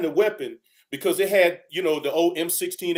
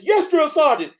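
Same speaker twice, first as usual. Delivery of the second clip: higher, louder sound-off; recruit's loud reply; 9 semitones higher, 6 dB louder than usual. Retold or imitated. Recruit sounds off to his drill sergeant, loud.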